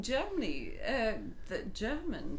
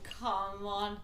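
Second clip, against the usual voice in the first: usual speaking voice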